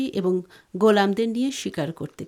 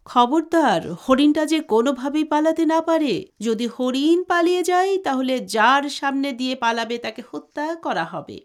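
loud, raised-pitch royal command; shouted warning; 4 semitones higher, 5 dB louder than usual. A narrator's reading. King warns his hunting party not to lose the deer, shouting. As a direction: shout a stern order to the whole hunt. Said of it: "চিৎকার মেরে বলল".

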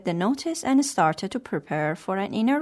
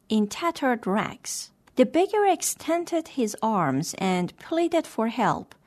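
plain reading voice

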